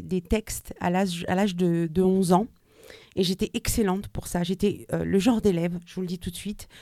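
normal talking voice

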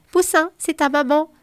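a fond mother's voice on the line; mother on the phone. Acted. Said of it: "une voix sur son portable".

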